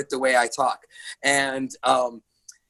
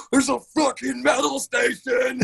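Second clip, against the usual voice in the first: high, slow mock scream of joy; hammed-up fanboy screech; 11 semitones higher than usual. Metal fan retells spotting the channel, ecstatic mock scream. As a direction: squeal it out like an overexcited fan. Retelling his thrilled discovery.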